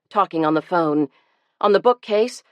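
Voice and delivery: usual speaking voice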